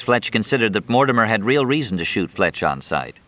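plain reading voice

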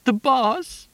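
high stricken call; shocked cry. A narrator's reading. Crowd reacts to collapse.

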